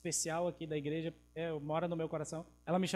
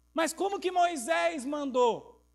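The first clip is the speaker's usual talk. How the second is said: indignant question; 8 semitones higher, 6 dB louder, slower than usual. Acted. Pharisees challenging Jesus.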